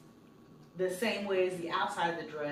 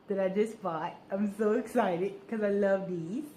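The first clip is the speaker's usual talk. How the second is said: odd put-on voice; 3 dB louder than usual. Real, not acted. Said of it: "I don't know why I'm talking like that".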